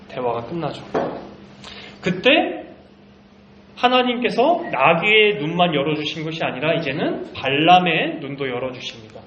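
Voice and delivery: usual speaking voice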